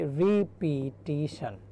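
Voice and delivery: Repetition stretched slowly syllable by syllable; drawn-out dictation. Shown as a demonstration. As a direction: sound out repetition in slow separate pieces. Teacher sounds out spelling word. (Repetition ধীরে ভেঙে ভেঙে উচ্চারণ)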